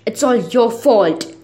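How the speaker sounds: slow, disturbing sneer; heavy sneer. A narrator's reading. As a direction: drag it out with open contempt. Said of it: "with a sneer so pronounced".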